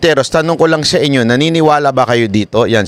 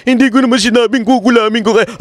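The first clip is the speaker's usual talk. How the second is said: higher sneering whine of the excuse; mocking imitation; 9 semitones higher than usual. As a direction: mimic the excuse scornfully. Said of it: "Sasabihin mo na naman sa tao". (panunuya, mataas na boses)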